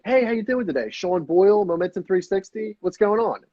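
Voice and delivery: high, lifted cold-call greeting; uptone. Shown as a demonstration. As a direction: pitch the whole intro up. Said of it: "It's the uptone".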